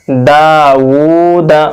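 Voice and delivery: "दाऊदा" with दा and ऊ held, last दा short; slow, measured recitation of "दाऊदा"; stretched long vowels. Shown as a demonstration. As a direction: draw out daa-oo, then a quick da in "दाऊदा". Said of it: "एक अलिफ के बराबर खींचकर पढ़ेंगे".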